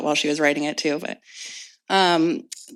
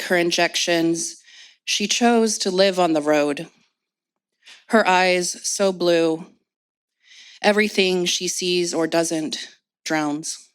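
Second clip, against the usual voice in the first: measured poetry recital with long pauses; poem reading. Acted. Poet reads of homeless mother to audience, measured recital.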